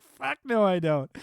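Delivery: loud, high scoffing refusal; loud mocking denial of the idea; emphatic no. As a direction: blurt out a loud disbelieving no. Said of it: "I'm like".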